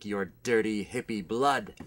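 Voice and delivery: low, slow, quiet threat; menacing vampire. Acted. Vampire guest threatening the hippie host, low and slow. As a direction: savour the threat like a predator.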